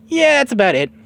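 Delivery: put-on high, loud kid voice; Goku. Goku wrapping up his answer, high and loud. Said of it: "He says".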